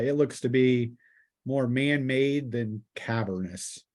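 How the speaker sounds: ordinary delivery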